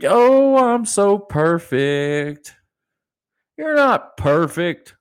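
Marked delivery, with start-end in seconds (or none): 0.0-2.5 high, slow, loud sneering prissy tone; mocking smugness. Mocking holier-than-thou people, slow and sneering. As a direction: ham up a smug hypocrite. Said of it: "Like, you".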